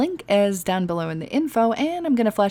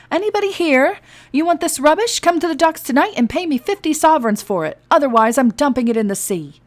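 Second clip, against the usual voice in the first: much higher, louder shout up to the balconies; 8 semitones higher, 7 dB louder than usual. Acted. A captain shouting threats up to the empty balconies. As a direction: holler into the dark. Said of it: "and shouted".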